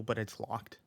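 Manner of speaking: ordinary delivery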